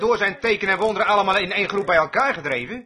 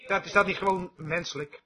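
ordinary delivery

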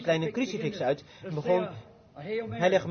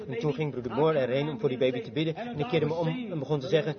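normal talking voice

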